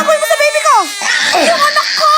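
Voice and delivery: hammed-up sobbing shout for her lost child; wailing mother. Mother on the plane wailing for her baby, hammed-up sobbing shout.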